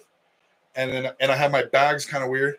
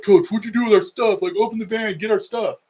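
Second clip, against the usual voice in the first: put-on higher, louder worried kids; kids' voices; 7 semitones higher, 4 dB louder than usual. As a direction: play the upset kids demanding their bags. Kids confront coach about stuff.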